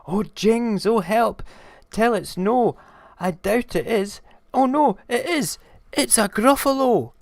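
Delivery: high, loud acted alarm; shrieky storybook panic; panicked mouse. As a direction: cry out in dread as the monster appears. Mouse meets the real monster.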